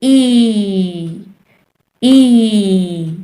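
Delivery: "ई" as the first vowel of igloo, held out; sustained, isolated vowel on "ई"; short I sound. Shown as a demonstration. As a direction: stretch "ई" to demonstrate the sound. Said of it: "आई का short sound है".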